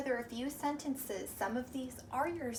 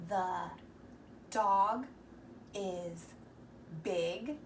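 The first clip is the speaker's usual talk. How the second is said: word by word, with pauses; slow reading aloud; 3 semitones lower, much slower than usual. Shown as a demonstration. Teacher to young readers, slow word-by-word reading. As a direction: read The dog is big one word at a time. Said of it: "did you say".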